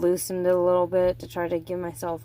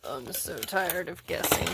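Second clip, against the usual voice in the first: usual speaking voice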